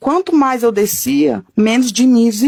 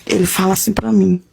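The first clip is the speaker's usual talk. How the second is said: ordinary delivery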